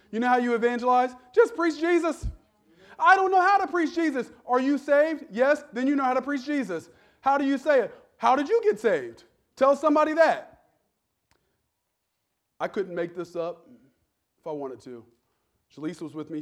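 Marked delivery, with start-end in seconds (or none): 0.1-10.6 higher, louder fiery preaching; preaching at full force. Acted. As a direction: thunder it at the congregation.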